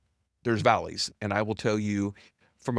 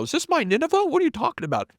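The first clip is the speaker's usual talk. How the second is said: high, louder mock-bewildered complaint; incredulous protest; 4 dB louder than usual. Founder questioning God like Jonah, high incredulous protest. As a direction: act out protesting an unwanted fate. Retold protest at his wilderness years.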